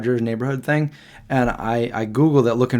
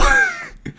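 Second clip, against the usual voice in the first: helpless high-pitched laugh; real laugh breaking up high; high squeal of laughter. Real, not acted. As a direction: lose it after the bit. Cracking up after the impression.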